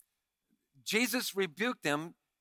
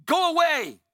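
demon crying out; 4 dB louder than usual. Acted. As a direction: hurl it at Jesus, crying out. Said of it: "cries out at the top of his voice".

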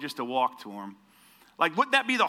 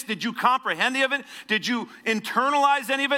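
building preaching; 4 semitones higher than usual. Acted. Pastor pressing the congregation about the test, rising intensity. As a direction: build the questions with mounting force.

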